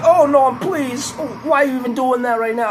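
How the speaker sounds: lightly done comic whine. Retold or imitated. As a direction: complain like an exasperated old man.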